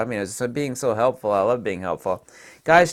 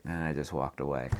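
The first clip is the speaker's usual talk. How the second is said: normal talking voice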